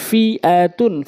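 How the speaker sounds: drawn-out syllable by syllable reading; slow demo. Shown as a demonstration. Teacher speaking so learners can follow, slowly. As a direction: stretch each syllable for learners. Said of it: "slow বলছি এই জন্য".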